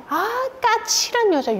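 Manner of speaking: high, drawn-out mock accusation; imagined reproach. Husband's words as wife heard them, reproachful. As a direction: label her with exaggerated reproach. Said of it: "요렇게 들렸거든요".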